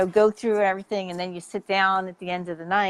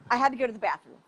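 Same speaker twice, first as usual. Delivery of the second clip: ordinary talk, nothing marked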